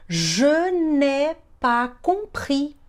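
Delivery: very slow, word by word; slow teaching demo. Shown as a demonstration. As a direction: stretch each syllable for learners. Teacher models phrase slowly. (demonstração bem devagar)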